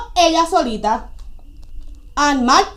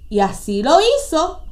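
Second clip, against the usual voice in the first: usual speaking voice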